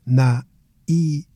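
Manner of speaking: "Naive" broken into na, i, ve with pauses; separated syllables. Shown as a demonstration. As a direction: sound out "Naive" the Portuguese way, piece by piece. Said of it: "call attention to the syllable separation".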